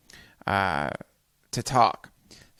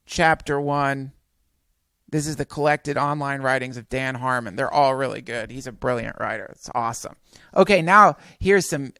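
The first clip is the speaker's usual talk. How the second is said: normal talking voice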